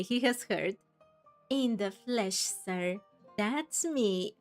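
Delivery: ordinary delivery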